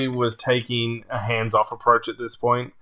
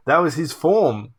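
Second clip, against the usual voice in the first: ordinary delivery, nothing marked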